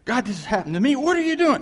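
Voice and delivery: hammed-up panicked prayer; frantic complaint. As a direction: cry out in mock desperation to God. Acted complaint to God.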